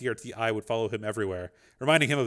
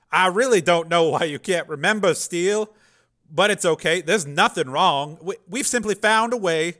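higher, louder anxious babble; nervous Dev; 7 semitones higher, 8 dB louder than usual. A narrator's reading. Dev lying to the program, anxious. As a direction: talk fast to cover.

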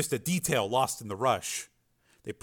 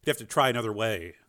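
plain reading voice

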